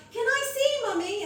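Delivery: put-on high, bright child's plea; little daughter asking to see the nappy, high and eager; eager little girl. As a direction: squeak it like an excited small girl. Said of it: "she'd be like".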